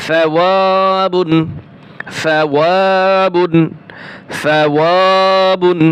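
each syllable drawn out slowly; slow, careful recitation model; slow model reading. Shown as a demonstration. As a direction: say it slowly for students to copy. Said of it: "teacher models the word slowly".

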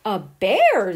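loud startled question; a bear? taken aback; frowning surprise. A narrator's reading. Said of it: "the little witchling frowned".

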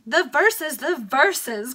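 high, loud eager cry; excited baronet eager for his poem. Acted. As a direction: call out eagerly. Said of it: "cried Sir Cherry".

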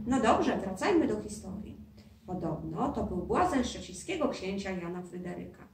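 ordinary delivery, nothing marked

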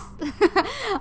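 short, loud giggle; laugh. Real, not acted. Player slipping up at sign-off, amused laugh. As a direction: burst out giggling.

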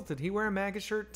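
ordinary talk

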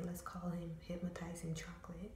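softly whispered nickname; whispering a secret nickname. Real, not acted. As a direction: let the name slip out in a whisper.

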